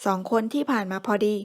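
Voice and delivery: usual speaking voice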